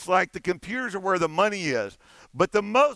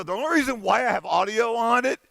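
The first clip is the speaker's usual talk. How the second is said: usual speaking voice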